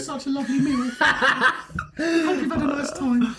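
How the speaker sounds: put-on high, slow giggly girlfriend voice; role playing girlfriend. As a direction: play the girlfriend, laughing through it. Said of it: "we're role playing".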